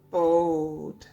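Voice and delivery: ordinary delivery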